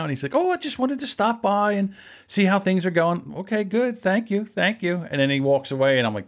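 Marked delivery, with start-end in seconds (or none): 0.4-3.3 high, light imitation of a hovering host; put-on innocent pleasantry; apologetic owner. 3.4-5.0 high, lightly put-on thanks; mock-polite brush-off; polite dismissal. Acts polite reply dismissing the owner.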